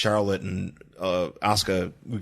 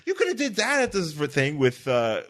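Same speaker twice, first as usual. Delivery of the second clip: higher, excited pitching of an idea; animated higher pitch; 8 semitones higher than usual. Retold or imitated. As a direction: sell the idea with rising glee. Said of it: "And I'm like".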